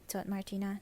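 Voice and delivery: usual speaking voice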